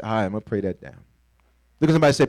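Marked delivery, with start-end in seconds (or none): 0.0-1.0 soft, much lower resigned calm; quiet calm resolve; calm resignation. Retold or imitated. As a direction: settle yourself and let it go. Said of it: "But I just said".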